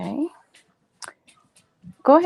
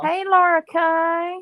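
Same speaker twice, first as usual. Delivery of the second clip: high, slow playful hello; sing-song greeting; 7 semitones higher, slower than usual. Pretend. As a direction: call out teasingly slow. Mock greeting friends seen live.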